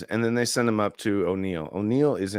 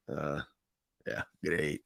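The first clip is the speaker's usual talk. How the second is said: fake Scottish accent; 7 semitones lower, 10 dB quieter than usual. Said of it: "fake Scottish accent".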